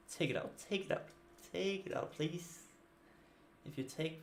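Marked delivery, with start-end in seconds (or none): 0.1-2.6 breathy, pleading under the breath, voice lifted; pleading whisper. Real, not acted. To the opponent, hoping he removes the card: hushed pleading.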